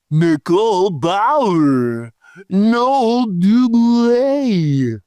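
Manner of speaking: slow, drawn-out mock roll call; hammed-up reader lingering on names; put-on name-reading voice. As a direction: stretch each name out in a put-on voice. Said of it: "do it in the same voice".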